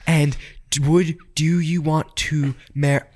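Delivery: halting mock proposal. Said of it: "she stops midway and she's like".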